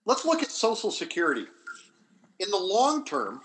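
ordinary delivery

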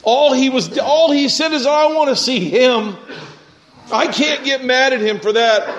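high-pitched, impassioned preaching; worked up. Real, not acted. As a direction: preach it with rising fervour. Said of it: "I'm getting all worked up".

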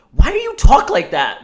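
loud, high, incredulous; exasperated. Retold or imitated. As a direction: demand it, fed up. Exasperated retort at host.

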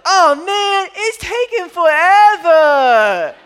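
put-on high, loud child wail, dragged out slowly; Charlie's complaining whine. Dad repeating his mimicry of daughter, high drawn-out wail. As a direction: wail it like a dramatic fed-up kid. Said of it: "That's exactly what it sounds like".

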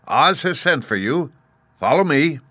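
soldier summoning. Acted. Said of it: "the soldier with the green whiskers".

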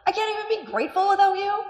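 high, loud, exasperated cry to God; exasperated plea. Retold or imitated. Preacher reenacts her exasperated prayer to God, high and loud. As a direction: plead as if at wits' end. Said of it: "this is the tone of voice".

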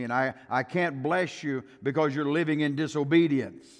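loud, rising pulpit preaching. Acted. Preacher warning the church against defying God, in full preaching voice. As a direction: preach it hard to the congregation.

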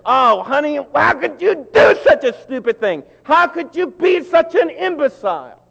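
high, loud scolding with a mocking laugh; loud mock-sweet scold; exasperated husband. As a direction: blow up at her over the bounced checks. Husband scolding wife over checks.

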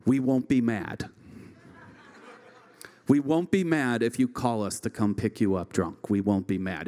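a hint of a caring parent laying down the rules; mild mock parental lecture; parent's speech. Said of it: "They would always add".

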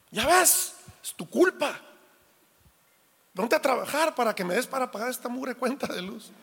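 a father blaming his daughter for the electric bill, hammed-up scolding; rude scolding father. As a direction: ham up the grumpy dad blaming her for the bill. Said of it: "el papá feo y, y, y rudo y grosero".

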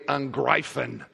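"Angreifen" said in German, pronounced "an-GRY-fen"; German word. Shown as a demonstration. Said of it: "That's attack in German".

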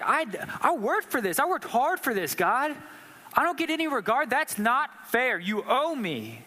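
high, indignant complaint that slows at the end; angry Cain. Retold or imitated. Cain bitterly protesting to God, high and indignant. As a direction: protest the unfairness, then drag out the last words. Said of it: "He's getting angry".

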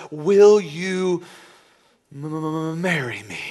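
stammering m-, m-, m- before marry me; a nervous groom stumbling over marry me; nervous stutter. Acted. Groom proposing to girlfriend, stuttering nervously. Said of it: "you stumble and you stutter".

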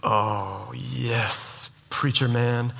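low, soft, drawn-out mock relish; smug inner thoughts. Preacher voicing a listener's private thoughts, smugly low and soft. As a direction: imitate a self-satisfied listener, hushed and low. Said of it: "you've been sitting here thinking".

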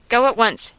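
plain reading voice

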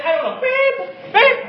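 put-on high, bright little girl voice; child's reply. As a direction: answer carelessly like a toddler, then beep. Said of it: "Emily just going through going".

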